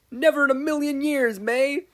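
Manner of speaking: high, loud rival's yell. Acted. Rival girl yelling back at Mei, loud and high. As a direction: shout her down across the room. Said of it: "yelled a girl".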